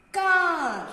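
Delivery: Car with a loud, punched final R; exaggerated word endings. Shown as a demonstration. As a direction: stress the ending sound of Car. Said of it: "What you hear at the end".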